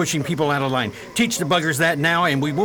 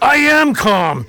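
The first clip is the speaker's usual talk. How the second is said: loud, slow, not calm at all; loud slow denial of anger; angry insistence; 8 semitones higher, 7 dB louder, slower than usual. Acted. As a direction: bark it out, furious. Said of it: "Calm down, Luis".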